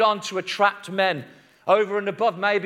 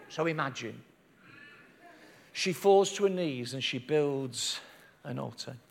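low, soft, with long pauses; quiet, intimate preaching; hushed solemnity; 7 semitones lower, 8 dB quieter than usual. A narrator's reading. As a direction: paint the scene gently and reverently. Solemn image of kneeling woman.

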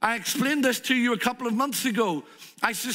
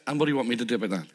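dismissive manager; 9 semitones lower, much faster than usual. Acted. Manager refuses Ben coffee.

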